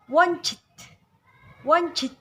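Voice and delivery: "Wonchit" punched high and loud; open-mouthed O in a forceful "Wonchit"; whole word stressed. Shown as a demonstration. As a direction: hit all of "Wonchit" hard. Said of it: "ditekan semuanya".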